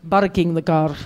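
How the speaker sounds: low mock Arabic accent, P turned to B; low, put-on Arabic accent; teasing accent imitation. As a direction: tease a friend's Arabic-accented English. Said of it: "I always tease my friend Osama about barking".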